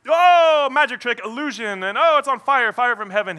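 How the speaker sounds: loud, high-pitched showman's "oh"; hammed-up wonder at a cheap trick; mock amazement. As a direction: gush like a phony stage magician. Mocks a fake miracle reaction.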